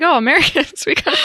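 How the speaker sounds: mock exclamation collapsing into laughter; laughing mock quote. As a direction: imitate someone while laughing. Mock Scots quote, breaking into laughter.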